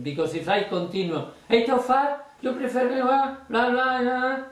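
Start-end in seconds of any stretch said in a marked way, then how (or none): 1.5-4.4 high, gushing, sing-song blah blah; expansive rattling on. Shown as a demonstration. Magician to spectator, expansively rattling on. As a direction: keep gushing on and on. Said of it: "the face the same and the attitude the same".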